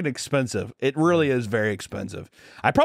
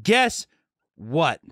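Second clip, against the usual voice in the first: imitating teacher; 3 semitones higher than usual. Health teacher setting up the next revelation, slow mock instructive voice. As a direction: split the two words for a big announcement. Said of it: "She says that".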